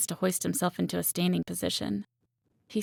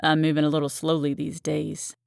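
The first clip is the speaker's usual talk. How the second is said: lightly voiced smooth, smug man; a touch of condescension in a smooth male voice; smooth, condescending; 2 semitones lower than usual. A narrator's reading. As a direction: play the cocky latecomer, understated. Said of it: "his deep voice smooth and condescending".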